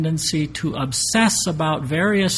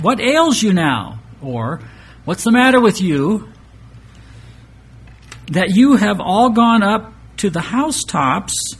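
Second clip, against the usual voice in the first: raised, louder proclaiming read; scripture reading; 3 semitones higher, 4 dB louder than usual. Acted. Preacher reads Isaiah's rebuke to the congregation: proclaiming. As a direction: declaim the prophet's rebuke with force.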